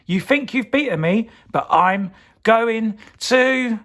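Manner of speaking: high, loud, slowing threat; screeching villain. Acted. Evil pea threatening Soup Potato, high screech. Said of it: "screeched the evil pea".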